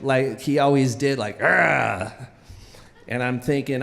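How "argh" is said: low growl, imitated; coach's growl. Coach climbing out of the pool at the kid, mock growl. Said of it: "he's growling at me".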